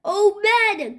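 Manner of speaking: high, excited exclamation; amazed cry. A narrator's reading. Cheeseball as the paper plane takes off, amazed cry. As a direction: cry out with pitch lifted high. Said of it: "cried Cheeseball".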